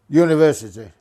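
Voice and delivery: loud, blunt one-word order; curt demand. Retold or imitated. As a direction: bark the destination at the driver. Curt passenger barking his stop.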